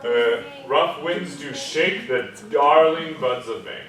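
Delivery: slow line with "darling" doubled and stressed; doubling on darling. Shown as a demonstration. Teacher showing doubling on darling. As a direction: lean into "darling", slowly. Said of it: "we could do one on darling".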